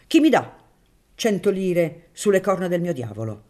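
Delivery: loud, high challenge; calling out. A narrator's reading. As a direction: throw out a dare at high pitch. Baron's boastful challenge in a wager.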